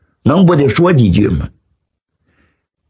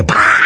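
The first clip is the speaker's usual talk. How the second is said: sharp, drawn-out "pa" smack; imitated table slap. A narrator's reading. Storyteller imitates Zhu Liang slapping the table, mouthed smack. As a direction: make the slap with your mouth.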